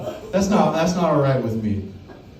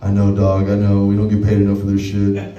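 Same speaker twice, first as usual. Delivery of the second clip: coworker voice; 8 semitones lower, 6 dB louder than usual. Retold or imitated. Coworker agreeing.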